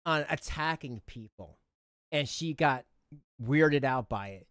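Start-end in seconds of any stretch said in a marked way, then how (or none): none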